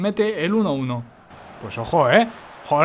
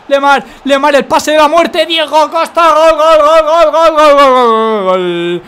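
building shout into a goal yell; goal celebration yell; 6 semitones higher, 8 dB louder, slower than usual. Real, not acted. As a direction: scream the goal like a commentator. Goal scored in FIFA match.

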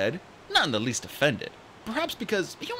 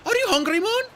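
put-on high, bright young dragonette voice; chirpy excited young dragon; eager little RainWing voice; Kinkajou's voice; 6 dB louder than usual.

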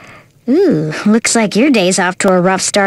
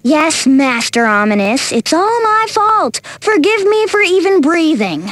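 sarcastic apology. Acted. Crewmate answers Master Ominous's blame, sarcastically submissive. Mock apology to the angry boss.

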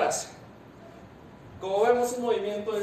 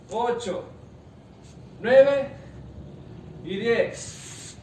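barking gym coach; 2 semitones higher, much slower than usual. Acted. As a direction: count the reps like a drill coach. Coach counting reps aloud.

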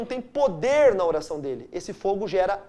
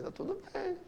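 soft, sheepish higher "Tá tudo bem."; embarrassed reply; 8 semitones higher, 14 dB quieter than usual. Retold or imitated. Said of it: "Eu falei". (resposta envergonhada, bem baixinho)

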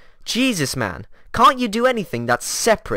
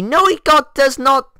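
angry denial; 8 semitones higher, 6 dB louder than usual. Acted.

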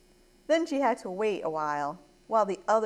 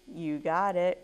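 usual speaking voice

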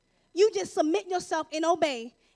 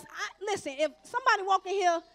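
ordinary talk, nothing marked

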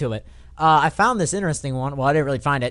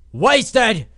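ordinary talk

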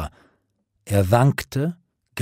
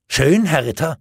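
jealous fury; 6 semitones higher than usual. A narrator's reading. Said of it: "vor Eifersucht und Zorn bebend".

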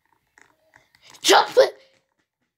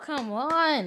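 normal talking voice

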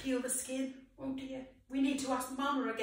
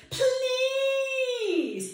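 high drawn-out whining please; whiny; 10 semitones higher, 4 dB louder than usual. Shown as a demonstration. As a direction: whine it like a hungry child. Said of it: "in your whiniest voice".

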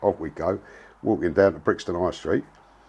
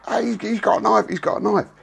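high, panicky cry of alarm; imitating a startled man yelling a warning; 13 semitones higher than usual. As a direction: yelp it out like you've just been cut. Attacker cries out in alarm.

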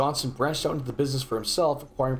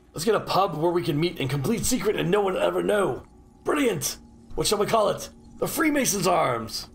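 higher-pitched, hammed-up plotter; mock enthusiastic Freemason; 5 semitones higher than usual. Player mocking Freemasons planning a secret pub, mock enthusiasm. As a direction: play a gleefully oblivious secret-society member.

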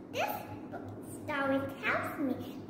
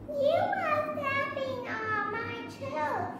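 higher, slower little mouse complaint; 8 semitones higher, slower than usual. Acted. Mouse telling the elephant he's on its toe, high. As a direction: squeak it up as the tiny mouse. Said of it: "said the mouse".

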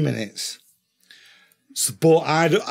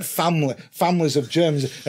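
ordinary delivery

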